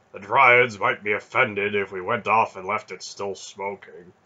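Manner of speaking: loud, projected character voice; strongly projected dialogue in a put-on voice; Beric's character voice. A narrator's reading. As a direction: voice Beric loudly, set apart from the narration.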